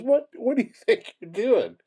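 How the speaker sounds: high-pitched challenge from a passerby; indignant man. Retold or imitated. Man confronts tree-cutting youth.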